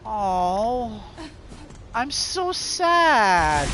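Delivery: high, slow hammed-up sad moan; mock sadness. Player pretending to mourn, slow high mock wail. Mock grief over game event.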